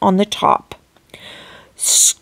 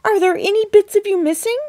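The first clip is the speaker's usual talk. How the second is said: worried cry; 9 semitones higher than usual. A narrator's reading. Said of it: "cried Missus T V".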